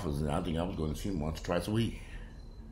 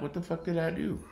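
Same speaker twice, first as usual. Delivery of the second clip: baffled retort; 10 semitones higher than usual. Retold or imitated. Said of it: "I'm like".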